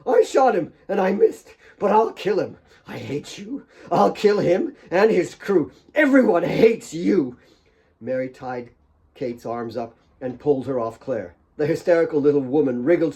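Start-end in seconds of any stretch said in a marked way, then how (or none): 0.0-7.4 high, loud hateful spitting; spat threats. A narrator's reading. Kate spitting hatred into Claire's ear. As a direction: spit the threats right in her ear. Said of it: "spat the words in her, in her ear".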